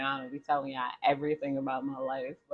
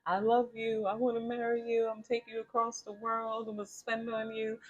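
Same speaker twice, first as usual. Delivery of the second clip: higher, softer lovestruck promises, lightly voiced; sweet-talking suitor; 7 semitones higher, 4 dB quieter than usual. A suitor sweet-talks a stranger far too soon, lightly voiced gushing. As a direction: gush promises like an eager new lover. Love-bomber voiced in advice.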